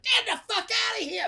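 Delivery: very high strained cry; hammed-up high-pitched panic; panicked mock yell. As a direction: yelp it as a man under attack. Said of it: "He's like".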